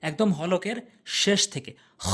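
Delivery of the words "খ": খ as a rasping fricative from deep in the throat; throaty kh. Shown as a demonstration. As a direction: scrape খ out from the throat. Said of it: "এরকম শব্দ করে উচ্চারণ করবেন".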